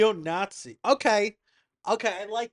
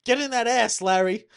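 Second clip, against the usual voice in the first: higher, louder brash Leon voice; Leon impression; 7 semitones higher, 5 dB louder than usual. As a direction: hype Larry up like Leon, loud. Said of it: "it's kind of like Leon".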